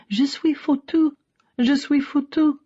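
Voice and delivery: high, distraught French voice; distressed cry. A narrator's reading. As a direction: voice a stricken mother pitched far up. Mother's distressed call, in French.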